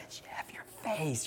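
hushed unvoiced whisper in church; imitating a whisper. Wife whispering a warning in church, hushed. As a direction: whisper it like a nudge during service. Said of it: "she'll be like".

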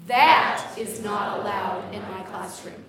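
"That" loud and drawn out, the rest slow and firm; stress on THAT. Shown as a demonstration. Teacher drilling the second version, stress on THAT. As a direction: lean hard on the first word "That". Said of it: "The second one is that".

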